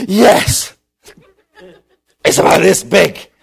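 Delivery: loud, high, winded cry; hammed-up out-of-breath runner; breathless report. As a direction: gasp out the good news. Breathless boy reports the cloud.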